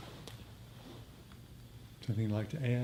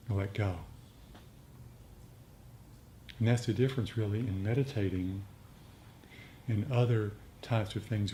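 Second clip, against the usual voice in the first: calm teacher easing them into stillness; guided meditation; 5 dB quieter than usual. Acted. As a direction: guide the group into letting go. Leads a meditation.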